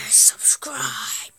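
breathy whispered subscribe; eerie whispered outro; whispered plug. Pretend. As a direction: whisper it like a secret. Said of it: "outro plug in odd voice".

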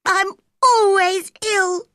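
self-pitying. A narrator's reading. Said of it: "started to cry".

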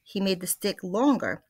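lively read-aloud to the class; story narration. A narrator's reading. Teacher reading The Twits to her class, lively narration. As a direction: read it with energy for the kids.

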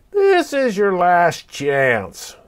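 slow, bored drawl. A narrator's reading. Fox waiting under the moon, bored and slow. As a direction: sound utterly uninterested. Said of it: "it said in a bored voice".

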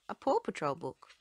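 usual speaking voice

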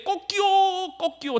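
high-pitched mock rooster crow. Preacher imitates the rooster that crowed at Peter's denial, high mock crow. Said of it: "닭이 두 번 우는 걸 듣고".

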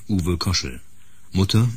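ordinary talk

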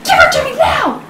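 high, tantrum-like plea; frantic demand. Acted. Mother demanding her teddy bear, frantic and high. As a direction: build to a desperate cry.

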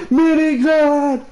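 high, drawn-out mock cry; mimicked panic callout. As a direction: stretch the word out high, mimicking a scream. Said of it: "The only thing I hear is this".

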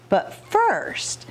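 ordinary delivery, nothing marked

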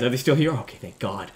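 normal talking voice

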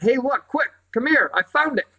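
high, eager call to the others; boy's excited discovery cry; excited yell. A narrator's reading. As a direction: shout out a thrilling find. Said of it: "Barney yelled in excitement".

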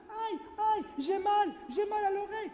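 put-on high, pained child's cry. Little rabbit crying to the doctor, high and pained. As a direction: whimper it out like a hurt little bunny. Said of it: "Pimpin cria".

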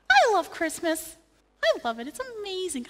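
a hint of mom gushing over Christmas; mom's voice. Son imitating his mom's gushing voice. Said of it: "she talks like this".

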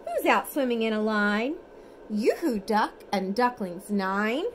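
lively storybook reading, sing-song; reading to children. Acted. Parent reads bedtime picture book to toddler, lively sing-song. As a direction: read it brightly for a toddler at bedtime.

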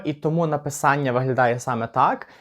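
usual speaking voice

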